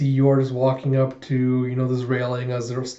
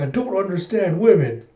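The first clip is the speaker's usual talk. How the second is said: raised-pitch comic complaint; exasperated bafflement; 5 semitones higher than usual. Acted. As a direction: throw up a baffled, exasperated protest.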